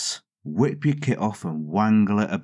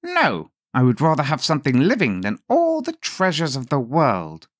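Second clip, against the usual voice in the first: high, louder imp voice; little man refusing; 7 semitones higher, 4 dB louder than usual. A narrator's reading. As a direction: refuse the riches with relish. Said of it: "the little man said".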